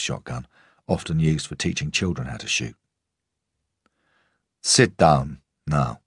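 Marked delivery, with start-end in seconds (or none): 4.6-6.0 slow, a hint of a Russian accent, firm; Russian-accented command. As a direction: draw out the order with a light Russian accent. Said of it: "the accent forever caught somewhere between rural England and his Russian homeland".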